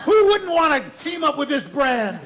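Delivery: high, loud self-mocking pitch; comic exclamation of false pride; mock boasting. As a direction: proclaim it loudly, laughing at yourself. Mock boast over shark film fame.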